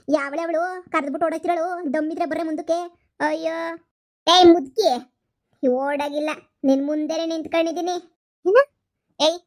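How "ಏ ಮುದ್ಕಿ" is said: put-on loud jeering yell at the old woman; taunting shout. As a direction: bellow it to rattle her.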